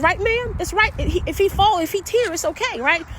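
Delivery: high, eager coaxing; encouraging. Retold or imitated. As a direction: cheer him through the balance. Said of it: "He's like".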